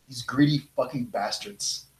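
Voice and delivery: usual speaking voice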